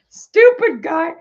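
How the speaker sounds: high, loud, slow laughing till crying. Real, not acted. Woman mocking the scammer, laughing to tears. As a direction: wheeze it out through tears.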